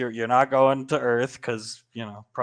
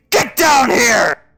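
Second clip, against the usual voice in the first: high, loud, slow bellow; angry yell; 10 semitones higher, 8 dB louder, slower than usual. Acted. Lorelai yelling at Scar on stage, furious bellow. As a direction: roar it at him.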